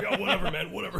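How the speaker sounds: imitated brush-off through laughter; laughing mock dismissal. Host imitating director asked for his opinion, laughing dismissal.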